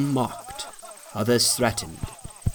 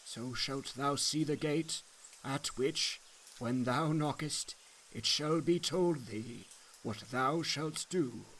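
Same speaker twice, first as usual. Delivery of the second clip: soft, slow, hushed guiding voice; Evangelist's counsel; 14 dB quieter than usual. A narrator's reading. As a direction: quietly point the pilgrim on his way. Evangelist directs the man.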